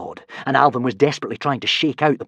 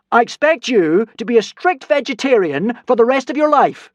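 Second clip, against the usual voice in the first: loud, high, mock-grand decree; god voice; 10 semitones higher, 7 dB louder than usual. A narrator's reading. Hiccup as a god ordering the consul.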